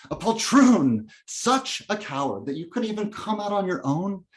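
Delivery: angry, emphatic string of insults; bitter tirade. Acted. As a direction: hurl each accusation at your father. Son's inner tirade against father.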